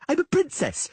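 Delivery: put-on high, loud indignant child; girl insisting to the Witcher; insistent princess. A narrator's reading. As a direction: insist on your rank.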